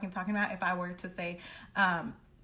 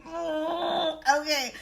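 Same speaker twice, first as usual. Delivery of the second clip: mock wail; 7 semitones higher than usual. Real, not acted. Heard cry.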